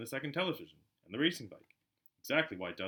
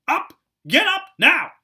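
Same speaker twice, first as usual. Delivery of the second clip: high, loud screeching wake-up call; shrill yell; 20 dB louder, slower than usual. Acted. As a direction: scream through the door at a sleeping boy. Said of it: "her shrill voice".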